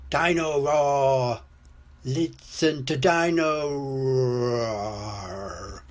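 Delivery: slow, dragging chant sinking at the end; slow down and let the roar die away; toy running down. A narrator's reading. Toy's batteries run out, slowing and sinking. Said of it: "Dino Roar stops walking and talking".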